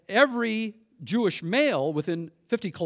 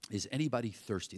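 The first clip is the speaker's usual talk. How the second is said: soft echo; 5 semitones lower, 10 dB quieter, faster than usual. Shown as a demonstration. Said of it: "That's the question I hear him asking".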